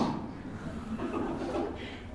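quiet wordless chuckle; laugh. Real, not acted. Laughing at her claimed degree, soft chuckle. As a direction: chuckle softly to yourself.

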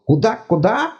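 high fussy god voice, apologetic; embarrassed god. Acted. God amazed.

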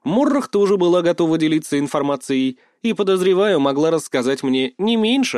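usual speaking voice

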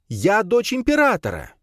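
high-pitched imperious declaration; indignant snarl. A narrator's reading. Princess facing down mages, indignant snarl. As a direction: proclaim rank in outrage. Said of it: "зарычала на них Надя".

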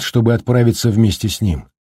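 plain reading voice

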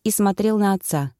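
ordinary delivery

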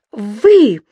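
loud, exasperated correction; losing control. A narrator's reading. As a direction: correct him, losing your temper. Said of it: "уже не владея собой".

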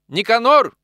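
loud, high shout of a name; calling out. A narrator's reading. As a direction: yell to wake a sleeping friend. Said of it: "крикнул Грузов".

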